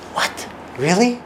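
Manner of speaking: rising, astonished what, really; wide-eyed surprise. Shown as a demonstration. Listener showing surprise to a speaker, astonished. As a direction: show big surprise as you listen. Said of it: "your eyes get big when they're supposed to".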